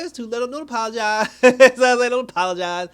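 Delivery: loud, high, amused dismissal; laughing rebuff. Retold or imitated. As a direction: brush off the apology through laughter. Teller jokingly rebuffs the dying girl's apology.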